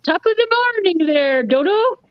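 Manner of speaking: high-pitched hammed-up Irish greeting; Irish accent. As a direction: put on a lilting stage Irish accent. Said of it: "So that was my Irish accent".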